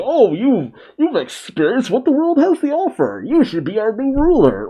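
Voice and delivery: mock their reasoning; sarcastic mimicry of the two kings.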